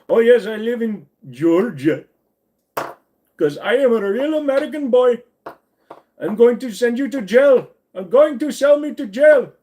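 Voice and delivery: high, loud mock Indian accent. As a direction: insist loudly in a hammed-up Indian accent. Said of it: "Do some Indian accent".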